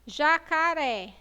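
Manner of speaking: JACARÉ slow, with the final RÉ held; drawn-out stressed É in Jacaré; stress on last syllable. Shown as a demonstration. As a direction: stretch Jacaré to show its strong RÉ. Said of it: "qual a sílaba mais forte".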